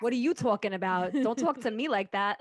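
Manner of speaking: hammed-up New York-Jersey accent. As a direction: talk back like an indignant Jersey local. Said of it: "New York accent".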